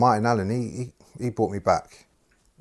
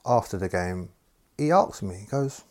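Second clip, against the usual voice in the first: ordinary talk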